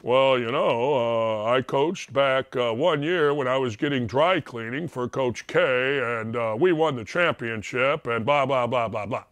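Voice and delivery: mocking low drone; Billis. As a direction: imitate a droning TV analyst, deep and dull. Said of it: "What do you get from Billis?".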